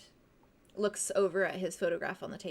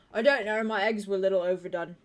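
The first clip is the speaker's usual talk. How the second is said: mouth full; 6 dB louder than usual. Acted. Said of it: "says something through, like, his full cheeks".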